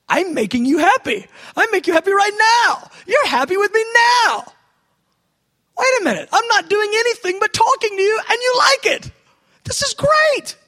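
overjoyed shouting in prayer; ecstatic shout. Retold or imitated. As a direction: burst out in delighted shouts.

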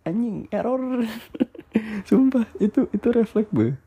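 high-pitched, breaking into laughter mid-sentence; laughing. Real, not acted. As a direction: retell it while cracking up at your own reflex. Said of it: "Ya gue ketawa karena".

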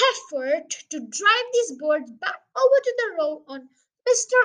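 slow, loud, officious pronouncement; ponderous police sergeant. Acted. Sergeant suggests driving the birds back, pompously measured. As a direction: lay out each word like a public address.

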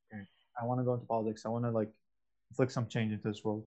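soft, fast inner voice imagining the decision; imagined inner thought. Pretend. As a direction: rattle it off under your breath like a passing thought.

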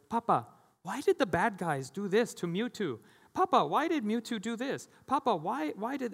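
put-on high, eager child voice pestering Papa; little son asking. Young son in the car after the movie, high eager child voice. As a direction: play a small boy firing questions at his dad. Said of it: "He's like".

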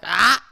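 short, loud imitated "ah"; mock outcry. Brother reacting to his discovery, mock outcry. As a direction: blurt out a sudden mock yelp.

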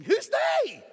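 mock indignant challenge.

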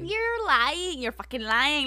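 high, loud, drawn-out accusing cry; hammed-up meme outburst; mock accusation. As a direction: accuse them theatrically, stretching "lying".

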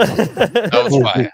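very slow, stretched That was fire; drawn-out praise. Pretend. Praising a friend's punchline, slow drawn-out hype.

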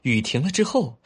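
plain reading voice

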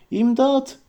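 high cry of help; a high-pitched call for rescue. Acted. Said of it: "diye bağırıp".

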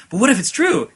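high, eager cartoon plea; crazy voice. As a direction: play the manic inner voice. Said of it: "the crazy one's like".

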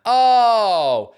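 ordinary talk, nothing marked

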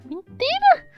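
high, loud outraged cry of protest; indignant shout. Real, not acted. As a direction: shout it down in disbelief. (protesto indignado, alto e agudo)

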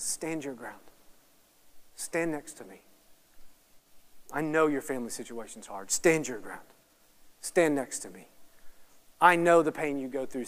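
low, hushed, steady voice; softly steady someone who is hurting; tender reassurance. Acted. Jesus speaks to those in pain, tender reassurance.

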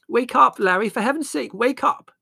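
high, loud, exasperated alarm; urgent shouting. A narrator's reading. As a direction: shout to rouse a heavy sleeper in danger. Mother shakes sleeping son amid fire.

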